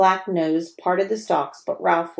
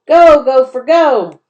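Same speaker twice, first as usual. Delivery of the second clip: high, loud cheering chant; girls yelling; 7 semitones higher, 12 dB louder, slower than usual. A narrator's reading. Girls yelling to cheer the gopher on. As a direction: cheer the gopher on at full volume. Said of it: "girls' voices began to yell".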